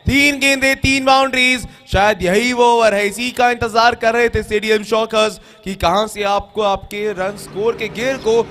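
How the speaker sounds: high, loud roaring boundary call; excited cricket commentary. Acted. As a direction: shout the third boundary home. Third boundary in a row.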